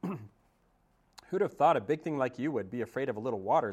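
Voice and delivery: put-on teasing old goose. Goose teasing the robot about water, amused. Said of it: "Loudwing laughed".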